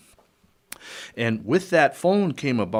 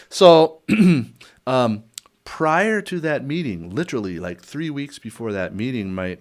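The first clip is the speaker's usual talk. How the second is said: usual speaking voice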